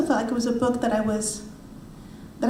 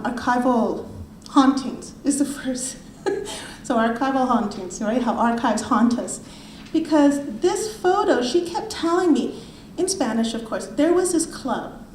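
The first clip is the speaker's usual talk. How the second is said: ordinary talk, nothing marked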